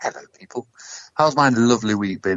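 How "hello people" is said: whisper. Acted. Whispered greeting to listeners.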